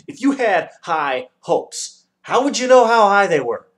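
very loud, high outraged shout; furious shouting. A narrator's reading. The Dodecahedron rants furiously at Milo, very loud. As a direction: rant at full volume. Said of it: "shouting furiously".

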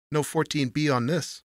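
bitter. A narrator's reading. Tony challenges the desk clerk over the registration, bitter. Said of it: "he said in a bitter voice".

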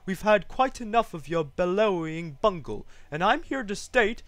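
high, indignant scolding; haughty, cross kangaroo complaint; snapping kangaroo. A narrator's reading. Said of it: "snapped the big kangaroo".

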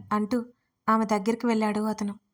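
plain reading voice

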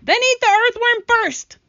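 loud, high-pitched yell; shouting. A narrator's reading. Centipede offering up the earthworm instead, shouting. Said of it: "shouted the centipede".

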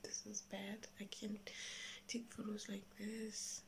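very soft dismayed whisper. Real, not acted. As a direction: whisper it, defeated. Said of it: "I have to whisper".

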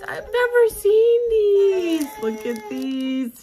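high near-tearful gushing; excited squeal. Real, not acted. Overjoyed at cute stickers.

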